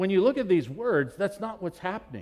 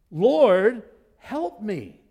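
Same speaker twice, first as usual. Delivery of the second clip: pleading cry; 4 semitones higher, 9 dB louder, much slower than usual. Acted. Repeats the woman's plea.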